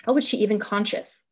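plain reading voice

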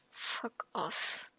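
soft, unvoiced muttered curse; muttered under breath. Real, not acted. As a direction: mutter it barely aloud. Muttered reaction while reading.

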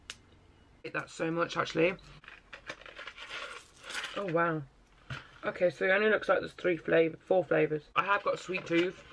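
talking while chewing; chewing candy. Real, not acted. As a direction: mouth partly full. Seen 0: candy put in mouth at -1.0, lips closed, chewing while speaking.

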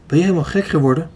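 plain reading voice

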